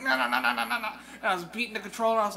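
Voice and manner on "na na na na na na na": higher, louder frantic na-na chant; mock button-mash chant. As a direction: hammer out the nonsense syllables. Mimicking button mashing again.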